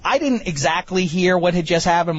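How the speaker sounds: ordinary talk, nothing marked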